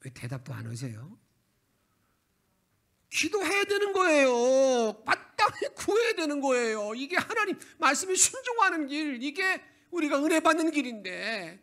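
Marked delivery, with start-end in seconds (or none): 3.1-5.0 emphatic loud answer. Real, not acted. Question then emphatic answer. 5.0-11.6 full-force preaching. Real, not acted. Preacher urges the congregation to pray, loud and impassioned.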